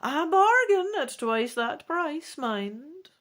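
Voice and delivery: put-on high, slow old woman's voice; high old fortune-teller voice; old shopkeeper woman. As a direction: draw the price out like a canny old merchant. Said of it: "Madame Ursula answers".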